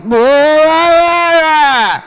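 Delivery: high, loud blah blah mockery; mock man droning. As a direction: drone it out like a bored guy tuning her out. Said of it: "And the man's like".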